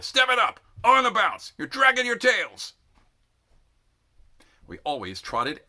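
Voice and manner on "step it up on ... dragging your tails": high, loud drill sergeant yelling; shouting. A narrator's reading. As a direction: drive the tired recruits on. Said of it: "shouting at us the whole way".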